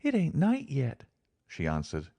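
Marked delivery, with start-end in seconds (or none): 0.0-1.0 put-on high, childlike voice of a thirsty girl; a small girl speaking innocently; little girl. A narrator's reading. Girl asks her companion for water, childlike.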